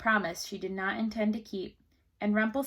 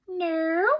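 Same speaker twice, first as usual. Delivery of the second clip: little man refusing; 7 semitones higher, 5 dB louder than usual. A narrator's reading.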